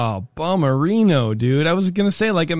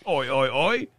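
put-on Cockney accent; 4 dB quieter than usual. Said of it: "with a thick Cockney accent".

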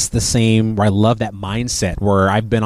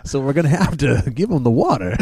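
higher put-on comic voice; Walt Disney; 4 semitones higher than usual. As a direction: impersonation with laughter under it. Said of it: "And Walt said".

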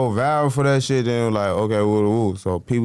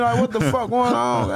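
pitched-up outraged rant; mock outrage; 10 semitones higher than usual. Retelling the theft to the host, mock outraged. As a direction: act baffled and indignant.

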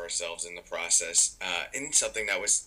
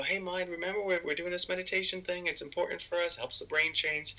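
gentle reminder; 8 semitones higher than usual. Retold or imitated. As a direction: talk your mind back kindly. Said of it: "And we go".

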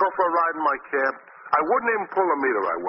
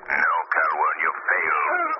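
much lower, ominous taunt; eerie hidden voice; 12 semitones lower, slower than usual. Acted. The Shadow taunting the villain from nowhere, low eerie voice. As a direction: speak like an unseen spirit.

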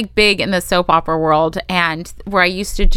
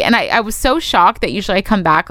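normal talking voice

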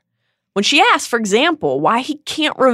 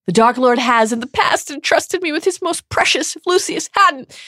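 Bellatrix protesting; 7 semitones higher than usual. Acted. Bellatrix continues defensive.

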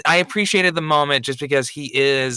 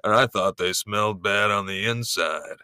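Han Solo impression; 4 semitones lower than usual. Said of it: "can I imitate Harrison Ford?".

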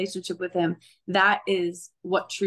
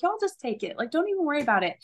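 kind offer; 6 semitones higher, faster than usual. Retold or imitated. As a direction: gently wave off the payment. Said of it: "I was like".

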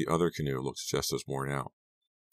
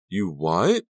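louder, rising, incredulous question; shocked disbelief; 4 semitones higher, 7 dB louder than usual. A narrator's reading. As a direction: demand it in raised-voice disbelief. Shocked reaction to Ricky's confession.

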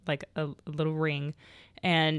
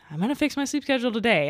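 high, fast, chirpy resolve; bright determined vow; 6 semitones higher, faster than usual. Retold or imitated. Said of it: "I was like".

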